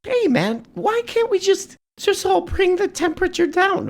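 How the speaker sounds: very high mock-peacemaker voice; mock falsetto pleading. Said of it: "Why are you saying it like that?".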